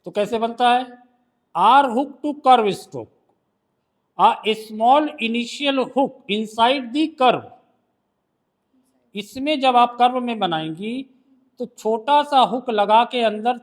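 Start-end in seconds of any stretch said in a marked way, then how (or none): 1.5-7.5 slow dictation. Shown as a demonstration. Teacher dictating a shorthand rule.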